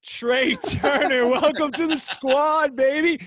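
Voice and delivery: high and loud, dragged out; excited celebration. Real, not acted. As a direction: celebrate the new signing. Celebrating his new player.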